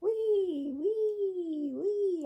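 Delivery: a husband playing at riding his bike, squealing high like a child; childlike wee. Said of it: "I'm riding my bike".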